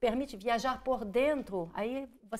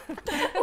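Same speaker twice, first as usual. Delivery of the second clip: bright burst of laughter, higher and louder; short laugh; 6 semitones higher, 4 dB louder than usual. Real, not acted. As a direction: laugh off the slip. Laughs at her own word mix-up.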